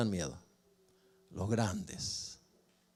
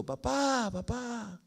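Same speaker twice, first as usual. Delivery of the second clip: high-pitched call to someone far off; 10 semitones higher, much slower than usual. Retold or imitated. Boy calling his father from far away, high call. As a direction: call his name across the field, much higher. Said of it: "le grito de lejos".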